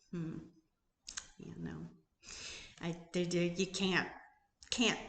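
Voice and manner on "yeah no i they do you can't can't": ordinary talk, nothing marked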